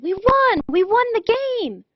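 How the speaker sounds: high, loud victory yell; yelled in triumph. Acted. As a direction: shout the win with joy. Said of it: "Then Tom yelled".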